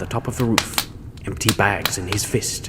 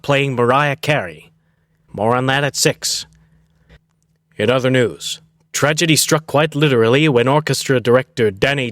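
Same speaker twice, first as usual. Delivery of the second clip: louder, higher newscaster voice; news anchor read; 3 semitones higher, 5 dB louder than usual. Acted. Mock anchor Mark Zipper reading the intermission news, bright anchor read.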